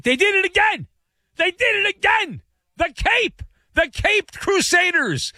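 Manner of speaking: very high, exultant cries; excited celebration. Acted. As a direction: crow over the underdog's result in a high voice. Celebrates underdog team's second tie.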